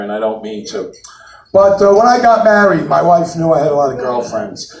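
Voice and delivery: ordinary delivery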